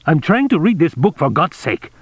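exasperated, high-pitched complaint; angry. A narrator's reading. Consul snapping at secretary over an interrupted book, angry. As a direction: protest the interruption crossly. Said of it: "he said angrily".

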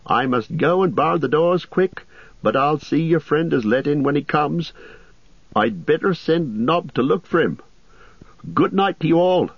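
innkeeper Butterbur. Acted. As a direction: chatter like a nervous landlord.

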